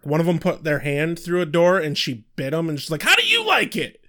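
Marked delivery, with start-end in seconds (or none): 3.0-4.0 high-pitched gleeful taunt; sing-out triumphant jeer; mock taunt. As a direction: fling it out high like a gotcha. Quoting a biting character's taunt.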